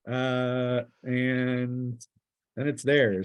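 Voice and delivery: ordinary talk, nothing marked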